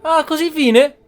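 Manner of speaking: ordinary delivery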